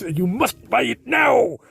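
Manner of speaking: mock pushy salesman shouting. A vlogger mocking a pushy tire salesman, in a mock shout.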